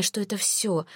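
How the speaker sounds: ordinary delivery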